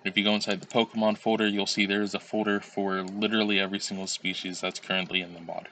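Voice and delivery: ordinary talk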